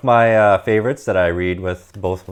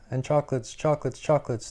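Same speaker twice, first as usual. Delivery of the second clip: higher and much softer, a far-off echo; cave echo; 7 semitones higher, 6 dB quieter than usual. Acted. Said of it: "the voice from the cave replied".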